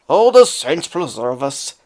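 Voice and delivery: slow, loud Irish whine; whiny Irish accent. Acted. Lazy Jamie whining to his wife in an Irish accent. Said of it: "Jamie would whine".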